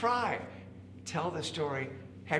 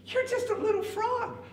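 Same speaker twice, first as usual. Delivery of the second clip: very high disbelieving squeal; incredulous rabbit. A narrator's reading. Rabbit doubting the little frog, high incredulous voice. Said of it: "the rabbit said".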